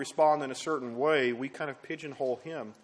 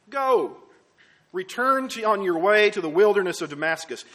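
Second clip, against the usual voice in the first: loud, raised proclamation; forceful pulpit reading, pitched up; commanding scripture reading; 6 semitones higher, 8 dB louder than usual. Acted. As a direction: declaim it as a divine command. Reads God's reply from scripture.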